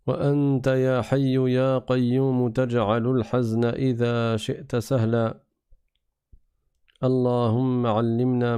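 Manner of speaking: measured, level-pitched devotional recitation; Arabic opening invocation. Real, not acted. Teacher opening his class with praise and supplication, reverently recited. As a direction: recite the prayer evenly, drawing out the long vowels.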